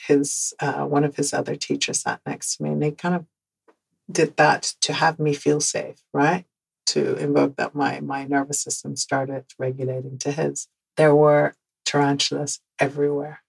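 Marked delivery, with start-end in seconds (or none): none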